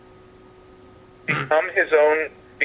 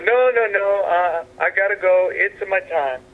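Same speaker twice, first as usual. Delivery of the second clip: put-on lightly Italian-accented Pope, higher; Italian Pope gently refusing; mild Italian accent, brushing off the crowd; 5 semitones higher than usual.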